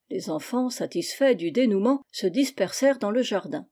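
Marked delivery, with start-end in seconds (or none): none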